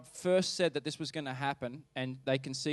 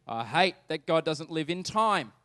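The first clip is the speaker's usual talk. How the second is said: normal talking voice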